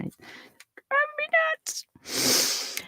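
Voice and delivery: breathy whispered gripe under her breath; frustrated whisper. Real, not acted. Fighting an eyelash mid-vlog, annoyed whisper.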